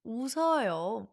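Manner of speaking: "웃어요" flowing as u-seo-yo; "웃어요" with the s sliding into the next syllable; smooth, linked. Shown as a demonstration. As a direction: model "웃어요" for learners to repeat, unbroken.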